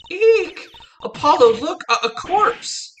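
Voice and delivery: high squealed mock alarm; hammed-up fright. Girl pointing out a corpse to Apollo, hammed-up shriek. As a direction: shriek in cartoonish horror, very high.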